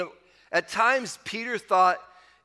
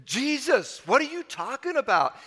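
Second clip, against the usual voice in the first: higher-pitched mock indignation; indignant, raised-pitch retort; 6 semitones higher than usual. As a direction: act out a disciple's indignant protest. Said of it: "there was this indignation".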